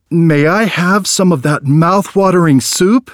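put-on the boy gathers his courage; brave boy's voice. A narrator's reading. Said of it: "Erman turned to the man bravely and said".

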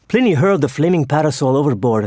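ordinary delivery

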